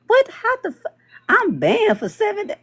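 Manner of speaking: high, cut-off disbelief at the ban; mock outrage. Acting out a banned user's outrage at the seven-day ban, high disbelieving squeak. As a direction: squeak out indignant shock.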